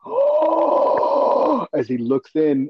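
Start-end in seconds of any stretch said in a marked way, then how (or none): 0.0-1.7 sharp high gasp then a high cry of oh. Retold or imitated. Fan reliving the reveal, gasping in high surprise. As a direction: catch your breath, then cry out high. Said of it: "And then I was like".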